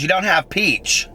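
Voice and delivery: high, slow, dismayed mock customer: You don't have peach. Mimicking the customer asking the ice cream shop for peach, high and slow. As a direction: stretch it out like a let-down customer.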